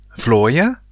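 alarmed question. Acted. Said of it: "roep hij zich hardop af".

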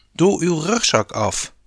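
urgent advice. A narrator's reading. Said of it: "raadde Eefje dringend aan".

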